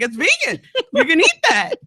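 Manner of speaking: a hint of a smug, pleased friend; proud friend. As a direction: mimic a friend beaming over his cooking. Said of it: "so proud".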